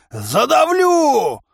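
very high, drawn-out threat; slow, stretched battle shout; joyful roar. A narrator's reading. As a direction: roar it with gleeful menace. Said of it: "радостно взревел мой противник".

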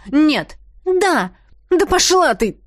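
loud, high snapping back; shouting quarrel. Acted. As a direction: yell it in the fight.

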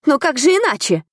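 high, bewildered question; surprised. A narrator's reading. Little man baffled by the steward's challenge, surprised. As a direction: ask in astonished disbelief. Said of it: "удивлённо уставился на меня коротышка".

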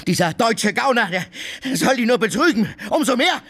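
high, manic shouting with laughter. Acted. Tarantjew ranting at the landlady, shouting and laughing. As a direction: rant high and wild, laughing between. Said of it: "Ich will schreien!".

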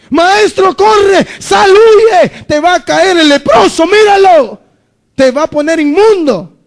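loud, high, alarmed cry to flee; frightened bystanders shouting a warning; panicked warning shout. Acted. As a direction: yell at the Master to run before the leper reaches him. Crowd warns Jesus to flee.

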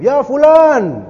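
high, loud, long-drawn call; acted calling shout. Muslims hailing their lost comrade at the fort, shouting. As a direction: call out to someone far off.

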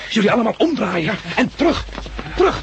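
high, loud commands to retreat; urgent loud retreat command; shouted orders. Acted. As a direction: bark them back to safety. Shouting orders under fire.

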